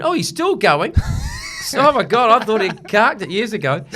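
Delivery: high, loud gossipy disbelief; mock astonishment. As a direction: exclaim it like a stunned onlooker. Mimics people amazed he's still going.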